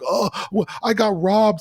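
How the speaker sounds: a hammed-up sudden alarm; panicked quote. Waking in the club, he panics about being robbed. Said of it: "And he's like".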